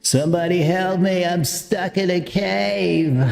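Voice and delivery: normal talking voice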